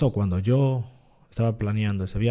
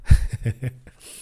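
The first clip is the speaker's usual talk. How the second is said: real burst of laughter at own mistake; hearty amused laugh; loud laugh; 4 semitones higher, 10 dB louder than usual. Real, not acted. Laughing at own booking mix-up.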